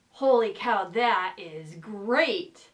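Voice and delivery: ordinary delivery, nothing marked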